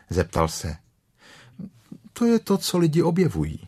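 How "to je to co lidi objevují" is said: high, quick, casual answer; breezy boy's know-it-all reply; offhand. A narrator's reading. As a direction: toss it off lightly and fast, high in pitch. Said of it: "řekl Krištůfek Robin ledabyle".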